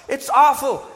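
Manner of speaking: "awful" drawn out, high and loud; mock horror. Preacher voicing the appalled priests, high and loud. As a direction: clutch your pearls on "awful". Priests horrified at temple scene.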